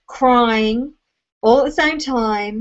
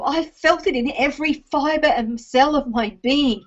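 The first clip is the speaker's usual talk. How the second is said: ordinary talk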